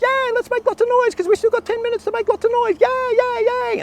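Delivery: put-on squeaky high, cheering boys; rowdy excited kids. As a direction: whoop it up like a kid at camp. Boys cheering before lights out.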